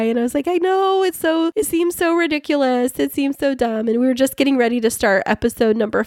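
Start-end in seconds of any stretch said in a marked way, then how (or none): 0.5-3.9 higher, teary embarrassed admission, lightly imitated; retelling her own weepy voice; near tears. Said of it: "I was, like, about to cry".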